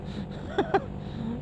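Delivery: short breathy laugh; soft laugh. Real, not acted. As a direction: laugh it off quietly. Laughs about stinging cold.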